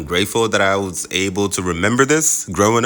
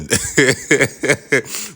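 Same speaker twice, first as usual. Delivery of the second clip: exasperated laugh; 8 semitones higher, 4 dB louder than usual. Real, not acted. Laughing at the power cut.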